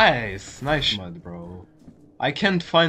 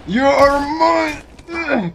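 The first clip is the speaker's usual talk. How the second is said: slow, high hammed-up villain; drawn-out mock menace, much higher; mock villain taunt; 9 semitones higher, much slower than usual. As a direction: gloat slowly like a cartoon baddie.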